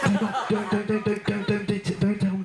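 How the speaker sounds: high, rushed begging; frantic pleading. Retold or imitated. Brother begging little sister not to tell Mum, high panicked pleading.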